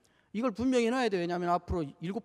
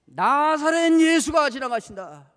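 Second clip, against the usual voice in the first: higher, louder, slow announcement; proclaimed line; 5 semitones higher, 8 dB louder, slower than usual. Acted. Preacher repeats what the blind man heard. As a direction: announce that Jesus passes.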